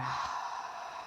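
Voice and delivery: soft, breathy sigh out through the mouth; audible exhale. Shown as a demonstration. Guide showing listeners how to exhale, soft audible sigh. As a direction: let a long breath out with a sound. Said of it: "exhale out through the mouth with a sound".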